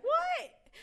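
a squeal of disbelief; shocked what. Retold or imitated. Reacting to her friend's banquet remark with a high, loud, shocked squeal.

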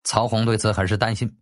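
ordinary delivery, nothing marked

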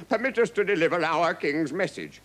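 formal captain. A narrator's reading. Captain asking the king for safe conduct, formal. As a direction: address the throne formally.

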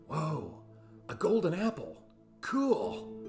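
normal talking voice

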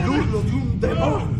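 loud, high cry of alarm; shout. Acted. The king cries out at the demon's howl, shouting. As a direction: yell it over the noise. Demonic howling fills the castle.

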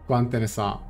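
usual speaking voice

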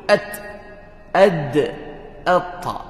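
At, ad, atta dengan huruf mati; drill with alif. Shown as a demonstration. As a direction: latih atta perlahan. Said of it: "kena masuk alif depan". (latihan atta)

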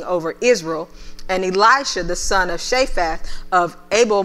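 preacher reading the King James to the church; scripture reading. Acted. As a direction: read the passage with weight.

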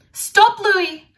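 loud high call after the dog. Acted. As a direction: shout for the runaway dog. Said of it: "called Julie".

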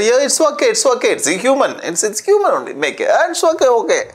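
high-pitched, acted-out waving away of an apology; mock forgiving reassurance. Host answering a guest's apology, high forgiving reassurance. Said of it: "People also opposite say".

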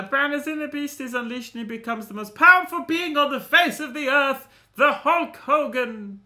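high, loud, theatrical proclamation; dramatic blurb reading. Acted. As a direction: proclaim it like an overblown trailer. Reads game blurb theatrically.

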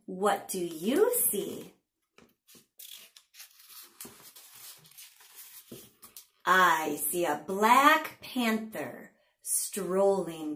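slow, sing-song picture-book reading; punchy, drawn-out storytime narration; read-aloud to children. A narrator's reading. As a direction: read it to little ones with big pitch swings and pauses.

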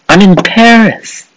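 high, slow thrilled cry; excited squeal. Real, not acted. Gamer thrilled to reach Paris, high squeal. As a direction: squeal it out with delight.

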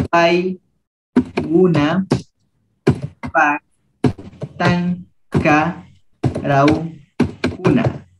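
Sería Paiguna Paktanga Rauguna drawn out syllable by syllable; slow teacher model; slow model reading. Shown as a demonstration. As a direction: spell it out slowly for the class. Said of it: "teacher models slowly".

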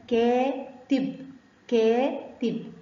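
كاتب split slowly as "kaa... tib"; slow, drawn-out first syllable in كاتب; long alif sound. Shown as a demonstration. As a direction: stretch the "aa" in كاتب, then pause before "tib". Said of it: "المد بالألف بيحب الفتحة".

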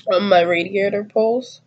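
normal talking voice

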